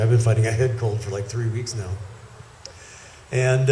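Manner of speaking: ordinary talk, nothing marked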